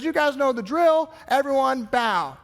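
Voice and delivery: lightly voiced herald summoning everyone; herald's call. The herald tells the party guests to bow, mock announcer call. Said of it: "The herald comes out and says".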